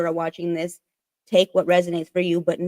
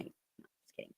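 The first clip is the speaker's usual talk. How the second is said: barely voiced, taking it back; whispered aside; 29 dB quieter, slower than usual. Real, not acted. Quick retraction aside.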